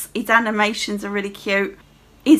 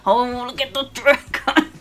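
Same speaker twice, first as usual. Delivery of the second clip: put-on gushing voice; mock your own delight at spotting a dragon; gushing self-imitation; 2 semitones higher than usual. Player imitating herself pointing out dragons to Marcus, gushing in a put-on voice. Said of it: "Marcus is so annoyed at me going".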